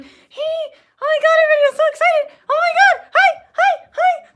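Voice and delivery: very high, loud mock-perky gushing; sarcastic parody of forced cheer, high and loud; fake bubbly excitement. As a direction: ham up a fake overexcited greeter. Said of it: "walk around like".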